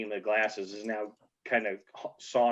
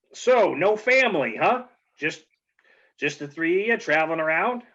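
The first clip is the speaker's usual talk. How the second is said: bartender; 5 semitones higher, 4 dB louder than usual. Acted. GM voices bartender questioning.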